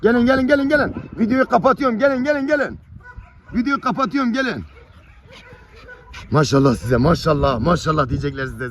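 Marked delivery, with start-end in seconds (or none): 0.0-4.6 very high, loud summons to puppies; calling out. Real, not acted. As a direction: call them over in a raised, high voice. (tiz ve yüksek sesle çağırma)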